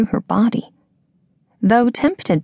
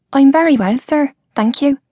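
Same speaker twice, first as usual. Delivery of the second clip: higher, lightly voiced young woman, polite; 6 semitones higher, 3 dB louder than usual. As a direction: lift the voice a little for her, courteous. Georgia replies to him.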